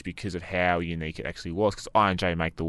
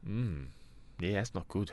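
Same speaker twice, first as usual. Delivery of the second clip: normal talking voice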